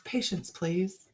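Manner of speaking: patience drawn out slowly and softly; slow soft card reading. Shown as a demonstration. Reading the tarot card to viewers, slow and soft. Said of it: "Next card is patience".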